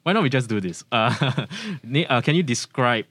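ordinary talk, nothing marked

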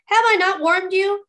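high, loud triumphant question; exclaiming. A narrator's reading. Youth proud to have warmed his cousin, exclaiming. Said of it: "he exclaimed".

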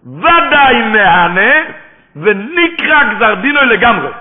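loud, high and drawn-out; slow, ringing preacher's shout; forceful declamation. Real, not acted. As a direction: proclaim the ruling at full force, word by word. Preacher hammers out the quoted ruling.

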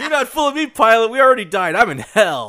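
mock panicked passenger. Passenger retort, high and loud.

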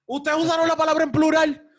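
loud, high indignant complaint; indignant protest. Pretend. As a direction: protest loudly and high-pitched. Acted protest at the boss.

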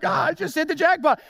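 high, loud thrilled gasp at a bargain; excited buyer. Retold or imitated. Shopper spotting cheap headphones, thrilled and loud. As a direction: squeal it like you found treasure.

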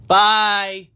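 sing-song farewell; high sing-song bye. Real, not acted. Second goodbye, voice shifted.

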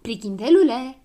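loud, teasing call. A narrator's reading. Cheeky girl at the door calling to the boy, loud. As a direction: shout it across the room. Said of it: "strigă ea".

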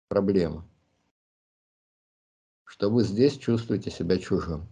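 ordinary delivery

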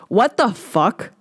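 slow, drawn-out what the fuck; stunned disbelief. Real, not acted. After reading Hal's quote, slow and stunned disbelief. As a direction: let the disbelief hang on every word.

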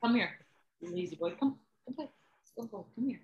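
high soft coaxing to a pet; talking to a dog. Real, not acted. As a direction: coax the lazy dog to play.

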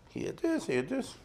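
soft, high-pitched imitated hedging; hushed mock evasion. Lecturer mimicking Haredi writers who won't judge, hushed evasion. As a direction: say it small and high, as if avoiding the subject.

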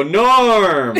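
loud, high group cheer of "Norm!"; joyful shout of a name on entering; bar crowd greeting. Retold or imitated. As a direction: call it like the whole bar greeting you. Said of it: "they go".

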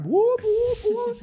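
very high whooping siren noise; mock alarm going off; alarm imitation. Imitating the prank box alarm.

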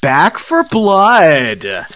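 slow, drawn-out winner reveal; suspenseful awards presenter. Pretend. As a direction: stretch the name for suspense. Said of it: "That sound effect did not have the intended".

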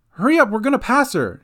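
loud, high, fast urgent call; urgent shout. A narrator's reading. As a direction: hurry them along at full voice. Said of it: "shouted Gran".

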